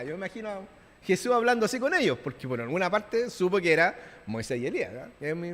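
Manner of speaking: usual speaking voice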